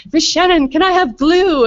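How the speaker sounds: put-on a small child asking the neighbor mom for glue, high and eager; little girl asking.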